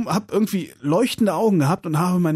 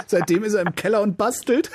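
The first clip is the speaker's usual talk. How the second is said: laughing; 8 semitones higher than usual. Real, not acted. Funny story about father.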